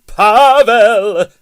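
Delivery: furious full-throated yell; outraged shout. A narrator's reading. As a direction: bellow it so the walls shake. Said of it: "the concrete walls quivered".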